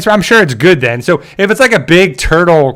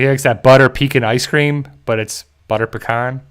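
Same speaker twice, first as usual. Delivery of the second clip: ordinary talk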